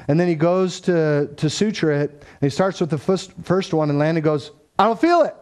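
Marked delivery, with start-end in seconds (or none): 4.8-5.3 put-on high, punched-up child's exclamation; retold delighted surprise. Son to dad during first stitch, pleasantly surprised. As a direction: pipe up as a suddenly relieved kid. Son's happy surprise at numb wound.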